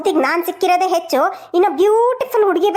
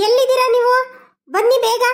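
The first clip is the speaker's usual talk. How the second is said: higher, urgent call to her husband; 6 semitones higher, faster than usual. Acted. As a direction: call him from across the house. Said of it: "ಅದ್ ಯಾಕೆ ಹಂಗ್ ಬಡ್ಕೋತಾ ಇದೀಯಾ?". (ಗಂಡನನ್ನು ಜೋರಾಗಿ ಕೂಗುವುದು)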